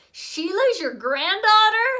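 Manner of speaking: put-on high, loud astonished kid question; a little boy amazed by the news; surprised child. A narrator's reading.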